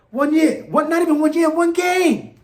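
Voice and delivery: high, loud, building exasperation; getting heated over one game; worked-up rant. Real, not acted. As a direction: hammer each word, fed up. Ranting about a rival fan.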